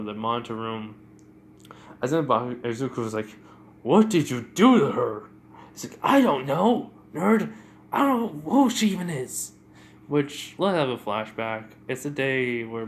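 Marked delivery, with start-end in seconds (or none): 3.8-5.2 loud, accusing outburst; heated demand. Retold or imitated. Izuku confronts Bakugo about the girl, heated demand. 6.0-9.5 high, loud, exasperated denial; angry retort. Retold or imitated. Bakugo snaps back at Izuku's accusation, angry retort.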